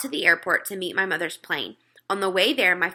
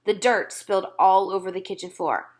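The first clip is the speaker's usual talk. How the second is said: usual speaking voice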